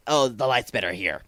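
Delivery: usual speaking voice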